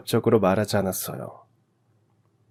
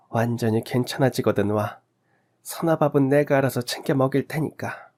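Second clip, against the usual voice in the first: lightly voiced neighbour lady, a little higher; kindly neighbour woman; 4 semitones higher than usual. A narrator's reading. Neighbour agrees to mind a sick friend's child, lightly voiced kindly woman.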